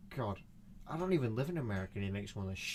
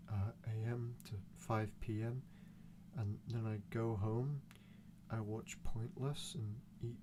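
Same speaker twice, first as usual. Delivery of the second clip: dull Steve type; 6 dB quieter than usual. Acted. One of those people reciting his daily routine, dull and monotone. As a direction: recite a dreary routine, with long pauses. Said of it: "those people that are like".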